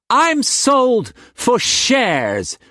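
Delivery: high, slow bitter words; bitterly. A narrator's reading. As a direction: spit out the hurt, slow and high. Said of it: "she said bitterly".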